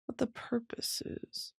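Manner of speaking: a hushed aside to yourself; whispered aside. Real, not acted. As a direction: whisper it under the breath. Aside while searching.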